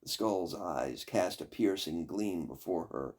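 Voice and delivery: usual speaking voice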